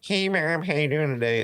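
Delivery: squeaky high mimicry, words slurred; mocking. Kid making little sister laugh, high mocking mimicry. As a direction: parrot it back in a silly high voice. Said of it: "I'm, like, mocking her".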